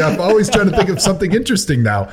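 high, fast indignant protest. Real, not acted. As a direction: protest hotly, pitch up. Indignant defense of his segment.

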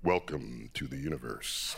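deep, slow, sonorous announcer voice; low and unhurried like a star show host; planetarium director voice. Shown as a demonstration. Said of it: "it's a planetarium voice".

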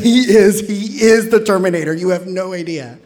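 loud, high, laughing insistence; half-joking loud protest; excited insistence. Retold or imitated. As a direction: swear he really is the Terminator. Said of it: "And I'd be like".

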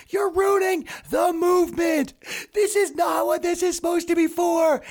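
high slow shouted scolding; yelling. Retold or imitated. Activist yelling at rioters wrecking a store, mock shout. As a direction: yell at them like a scolding activist. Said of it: "starts yelling at them".

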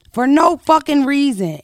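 ordinary delivery

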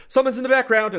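high-pitched mock musical alarm; blaring soundtrack alert. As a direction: sing it out high, like an obvious horror cue. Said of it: "the soundtrack's like".

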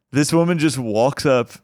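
usual speaking voice

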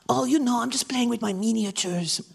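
put-on accented puppet voice. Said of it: "Why are you talking like that?".

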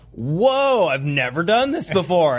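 high-pitched "whoa", settling slightly after; retold super excitement. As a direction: re-enact your own giddy amazement. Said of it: "I was super excited".

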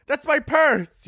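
high, drawn-out mock cry; imitated mugging victim. Victim in the alley next door, hammed-up protest. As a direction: wail it like a distant victim. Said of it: "you hear someone be like".

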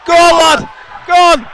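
loud, high shout of encouragement; cheering shout. Real, not acted. Co-host cheering a player live on the podcast, shouting. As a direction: yell the player on.

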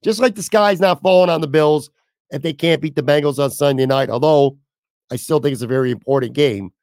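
normal talking voice

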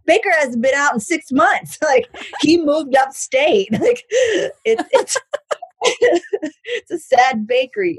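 high, loud, through laughter; cracking up. Real, not acted. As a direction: laugh helplessly while getting the joke out. Joking about a sad bakery, laughing.

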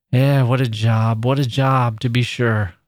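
manager lamenting over the dead collier; troubled old manager. Acted. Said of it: "rubbing his brow with trouble and perplexity".

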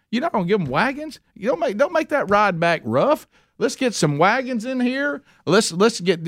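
high, eager Pharaoh outdoing the plan; excited Pharaoh. Acted. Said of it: "Pharaoh's like".

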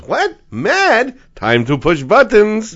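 high, gleeful imp voice; little guy in child's mind. Acted. Mischievous voice inside a child hearing mad, eager squeal. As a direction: pipe up high, then loud and drawn out. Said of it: "There's a little guy in the corner who goes".

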